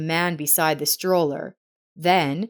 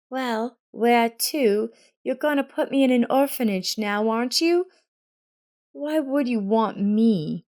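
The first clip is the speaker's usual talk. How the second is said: put-on hurt girl; 6 semitones higher than usual. A narrator's reading. Hurt girl challenging adults.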